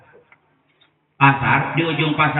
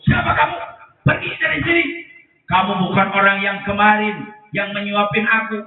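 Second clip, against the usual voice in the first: put-on much higher indignant old blind man; blind man's high angry voice; 5 semitones higher than usual. Blind man rejecting Abu Bakar, high angry protest. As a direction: chase off the stranger in a high cry. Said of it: "Kata orang kata Yahudi".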